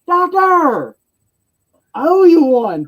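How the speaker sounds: giddy sidekick shouting thanks; excited prisoner. Retold or imitated. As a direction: pop up and squeal your thanks.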